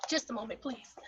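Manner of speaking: strained, near-silent choked voice; forcing words out while choking. Real, not acted. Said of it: "Something's choking me".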